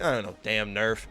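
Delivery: normal talking voice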